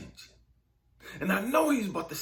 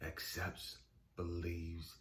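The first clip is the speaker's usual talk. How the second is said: low, quiet voice with measured pauses; soft, deep pronouncement, unhurried at the close; God; 11 dB quieter, slower than usual. Retold or imitated. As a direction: let each word land softly and low. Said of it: "And he said it again".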